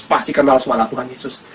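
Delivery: normal talking voice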